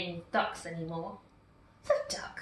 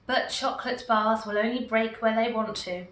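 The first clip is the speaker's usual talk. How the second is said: plain reading voice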